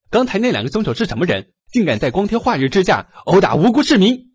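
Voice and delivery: fast, high, loud outrage; indignant question. Acted. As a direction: demand answers in anger. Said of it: "气愤地问道".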